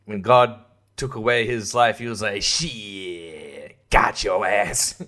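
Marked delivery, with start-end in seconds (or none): 2.4-5.0 much lower, slow and drawn out; low slow God voice. Retold or imitated. God gloating at the dead actor, low drawn-out voice. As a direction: drawl it like a smug deity.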